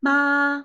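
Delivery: Ma held high, level and loud; first tone. Shown as a demonstration. Teacher demonstrates the first tone, high and level. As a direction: sing out Ma on one flat high note. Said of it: "basic tones".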